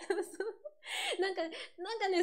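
high-pitched words through giggles; laughing agreement. Real, not acted. Singer amused by her own dialect examples, laughing. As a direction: agree while breaking into laughter.

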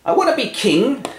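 high whining I wanna; whiny wish. Acted. Hamlet whines to himself about the crown, high and childish. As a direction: whine it like a sulky kid.

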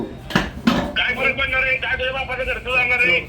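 angry shouting. Real, not acted. Caller yelling back at the man on the phone, angry and loud. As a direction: shout him down in real anger. Heated phone quarrel over reservation.

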